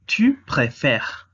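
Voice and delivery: "Tu préfères" said slowly, stress on the final "fère" with an open è; slow French model. Shown as a demonstration. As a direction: dictarlo despacio en francés como modelo. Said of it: "la conjugación es préfère". ("Tu préfères" despacio, con la è abierta de "fère" marcada)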